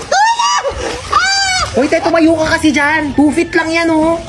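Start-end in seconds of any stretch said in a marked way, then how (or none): 0.0-1.6 high, hammed-up cries for help; mock drowning panic. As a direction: play it like you're going under, over the top. Pretends to drown in pool.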